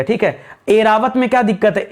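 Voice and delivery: ordinary talk, nothing marked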